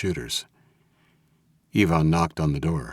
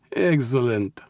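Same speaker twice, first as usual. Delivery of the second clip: higher-pitched, pleased mob boss; pleased Russian boss, pitched up; pleasant Russian mob boss; 7 semitones higher than usual. A narrator's reading. Mob boss hears of his captive's state, pleased.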